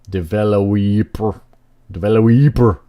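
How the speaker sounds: drawn-out 'developer' with a stretched WEEP; misread typo. Shown as a demonstration. Player relishing a typo in the game's text, drawn-out mispronunciation. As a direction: say 'developer' as devello-WEEP-er, slowly. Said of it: "I'm not making fun of typos".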